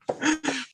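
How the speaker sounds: sudden high burst of laughter. Real, not acted. Host laughs at co-host's school-paper joke, a high burst of laughter.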